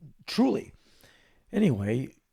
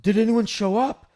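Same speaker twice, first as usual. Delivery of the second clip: [name] impression; 9 semitones higher, 7 dB louder than usual. [name] voiced, worried.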